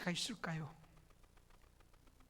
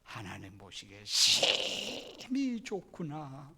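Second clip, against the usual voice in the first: scripture reading; 2 semitones lower, much slower than usual. Acted. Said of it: "오늘 삼십일 절 말씀 보면".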